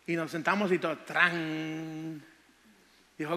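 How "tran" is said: long, held "tran" of a strummed chord; imitated guitar strum. Man retelling his first guitar lesson, mimicking the strum.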